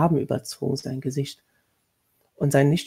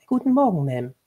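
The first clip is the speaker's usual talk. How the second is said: curtsy with the voice, small and proper; polite maid; 3 semitones higher than usual. A narrator's reading. Young maid greeting the lady of the house, meek.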